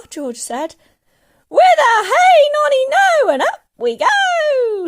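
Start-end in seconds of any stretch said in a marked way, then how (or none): 1.5-4.9 put-on screechy old woman whooping; very high, slow, full-force holler; gleeful shout. A narrator's reading. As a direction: crow it out wildly, stretching the words. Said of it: "she shouted".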